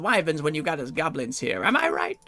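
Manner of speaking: high, loud cheeky goblin. Acted. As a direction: hawk it like a brash goblin. Voices goblin zeppelin NPC.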